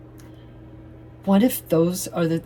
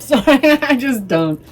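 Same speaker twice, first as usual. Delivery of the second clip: loud, high burst through a laugh; laughing apology; 9 semitones higher, 10 dB louder than usual. Real, not acted. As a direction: laugh out an unapologetic sorry. Laughing apology for blunt opinion.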